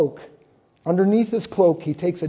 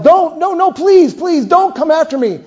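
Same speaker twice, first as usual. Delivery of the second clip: high, loud desperate pleading; 10 semitones higher, 6 dB louder than usual. Acted. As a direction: beg him to stop, higher and louder. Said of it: "Abner keeps pleading with Asahel".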